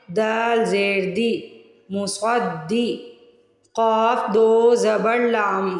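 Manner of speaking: slow, drawn-out letter spelling; patient slow teacher spelling; slow spelling drill. Shown as a demonstration. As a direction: spell each sound deliberately for beginners. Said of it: "spelling drill, letter by letter".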